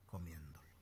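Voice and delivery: hushed, unvoiced whisper; whispered for effect. Real, not acted. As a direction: drop to a breath of a whisper. Sermon drop to whisper.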